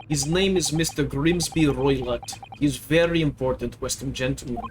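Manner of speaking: put-on broken English in a heavy Russian accent; proud Russian crewman correcting the girl; gruff Russian sailor. Sailor to the maid, Russian accent, offended.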